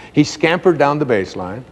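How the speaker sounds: usual speaking voice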